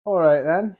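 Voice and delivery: a quick, chirpy British "All right then"; accidental British accent on "All right then". Real, not acted. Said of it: "I just sounded British right there".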